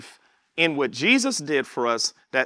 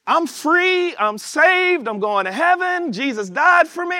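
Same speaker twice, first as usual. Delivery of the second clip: higher, rejoicing free-and-saved cry; joyful declaration; 10 semitones higher than usual. Retold or imitated. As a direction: proclaim it like a freed soul. Voicing a joyful baptized believer.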